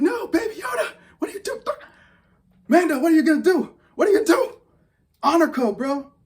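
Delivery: high, loud pleading yell. Retold or imitated. Fan yelling at the TV over Baby Yoda, high loud yell. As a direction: scream at the screen in alarm. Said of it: "I'm yelling at the T V".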